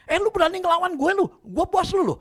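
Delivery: loud, high furious boss yelling at the maid; angry boss shout. Retold or imitated. Boss yelling at his new maid, loud and furious. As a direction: bark it like a bully boss.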